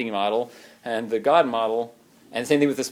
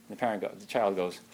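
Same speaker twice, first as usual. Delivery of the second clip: ordinary delivery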